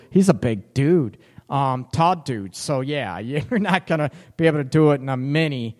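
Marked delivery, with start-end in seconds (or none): none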